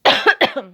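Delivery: short throat-clearing cough. Real, not acted. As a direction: cough once, apologetically. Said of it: "I'm gonna cough".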